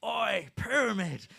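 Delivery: loud, drawn-out yell of a fan; imitated yell. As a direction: bellow it like a fan across the room. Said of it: "he would yell at me".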